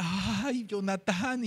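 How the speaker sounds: long aching sigh on the first word; mildly acted heartbroken tenderness. David sees Jonatán in the son, heartbroken sigh. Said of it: "se le quebraba el corazón".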